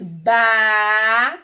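"ba-ca-te" loud, long syllables with gaps. Shown as a demonstration. Teacher pointing out the remaining syllables, slow and separated. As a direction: draw out each part of "ba-ca-te". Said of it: "tem todas as sílabas".

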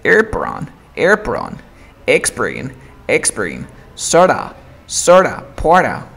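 "Airport on", "Expert in", "Sorta" with T dropped; linked drill. Shown as a demonstration. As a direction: drill "Airport on", "Expert in", "porta" as single words. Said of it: "enfócate en la R T O".

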